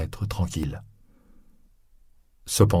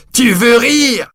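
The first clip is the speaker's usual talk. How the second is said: loud high scornful laugh then jeer; mocking laugh; 7 dB louder, slower than usual. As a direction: laugh in his face. Javert mocking Valjean's plea.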